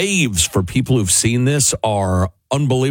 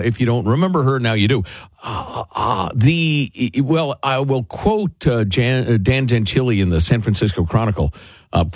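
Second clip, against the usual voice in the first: ordinary talk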